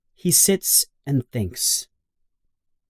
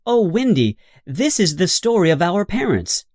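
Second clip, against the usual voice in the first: John exclaims; 7 semitones higher than usual. A narrator's reading. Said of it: "says John".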